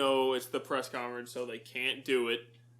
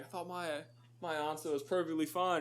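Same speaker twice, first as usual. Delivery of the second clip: quiet, higher mock British accent; bad British accent; 4 semitones higher, 5 dB quieter than usual. Retelling a British guy at trivia, in a mock British accent. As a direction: put on a horrible British accent for the complaint. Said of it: "excuse my horrible British accent".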